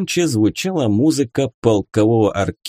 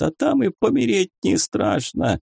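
high, feeble, resigned pleading; wounded soldier lamenting weakly; plaintive lament; 10 semitones higher than usual. A narrator's reading. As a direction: keep it high and pitiful. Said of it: "продолжал причитать солдат".

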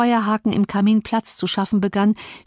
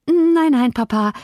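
distressed daughter; 6 semitones higher, 4 dB louder than usual. A narrator's reading. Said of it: "brachte sie hervor".

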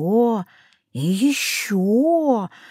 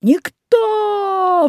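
much higher, bellowing cyclops; roaring giant; 11 semitones higher, much slower than usual. A narrator's reading. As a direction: roar it in pain. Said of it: "взревел гигант".

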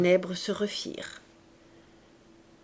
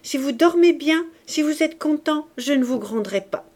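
higher, louder lilting conditions; playful coaxing; 6 semitones higher, 5 dB louder than usual. A narrator's reading. Cosette coaxing her father to be well, playful coaxing. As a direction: coax him with a lilting list. Cosette coaxes Jean Valjean.

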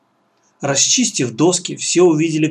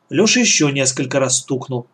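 ordinary delivery, nothing marked

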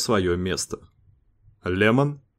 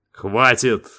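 curt cut-off; 3 dB louder than usual. A narrator's reading. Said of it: "отрезал Дэйв".

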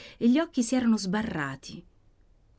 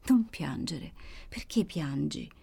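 understated grave, weak voice; slowing into a slightly deeper, softer tone; 3 semitones lower, 3 dB quieter, slower than usual. A narrator's reading. Wounded sister tells Maddalena not to cry, gravely. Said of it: "con una voce singolare, grave, profonda".

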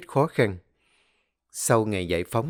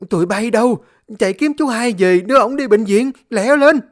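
high, loud frantic yelling; panicked shout; 12 semitones higher, 8 dB louder than usual. Acted. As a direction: scream orders in panic. Panicked wife calling workers. (hét hoảng loạn gọi người)

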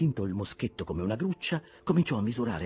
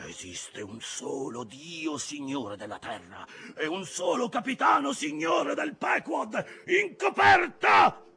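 exclaimed threat; 7 dB louder than usual. A narrator's reading. Ahab aims a musket at Starbuck, mounting furious shout. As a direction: start measured, climb to a furious bellow. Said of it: "esclamò".

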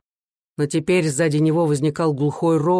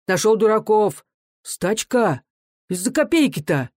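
higher-pitched scornful outbursts; agitated exclamations; 5 semitones higher than usual. A narrator's reading. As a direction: toss out heated cries from the crowd. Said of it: "Возбуждённо запрыгали слова".

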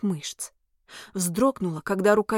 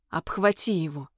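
low command; 4 semitones lower than usual. A narrator's reading. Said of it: "получила приказ".

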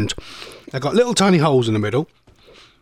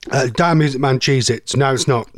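ordinary talk, nothing marked

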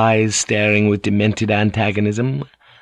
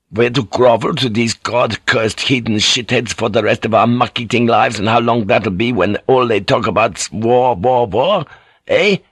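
put-on bitter, ranting sailor with a foreign accent; Fink. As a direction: rant in disgust, pressing each complaint. Fink rants at the pilot.

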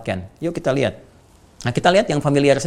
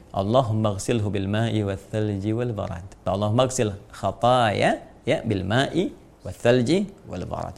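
slow, softer recitation of the du'a; slow Arabic recitation; 3 semitones lower, 3 dB quieter, slower than usual. Shown as a demonstration. Teacher reciting the washing du'a, slow and reverent. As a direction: intone each Arabic word slowly, reverently.

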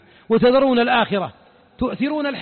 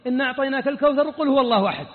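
rushed recitation; faster than usual. Retold or imitated. Mimics rushing through short surahs.

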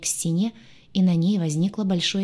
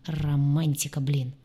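ordinary delivery, nothing marked

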